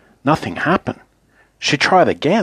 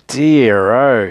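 drawn-out exclamation of dismay; exclaiming; 4 dB louder than usual. A narrator's reading. Mister Topsy-Turvy hears what had happened, exclaiming. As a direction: exclaim it with mild concern. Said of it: "he exclaimed".

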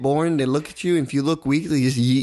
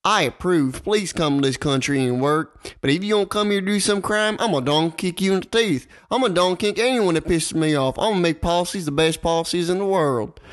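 higher-pitched Southern campaign rant; mock Southern candidate making big promises; bad Southern accent; 4 semitones higher than usual. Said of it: "That was the worst Southern accent".

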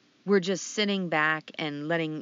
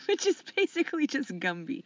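laughing through a teasing jab; giggling as you poke fun at your cohost; light laugh mid-line; 8 semitones higher than usual. Real, not acted.

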